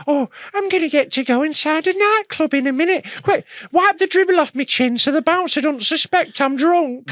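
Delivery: put-on high-pitched excited old man; mock a doddery old man, squeakily high; put-on high old-timer voice, giddy; pathetic old clubber. Mocking old men queuing for club.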